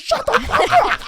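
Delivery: a very high, loud snapping yell at the driver; retold furious yell. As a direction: blow up at your friend in the car, full volume. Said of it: "we both yelled at each other".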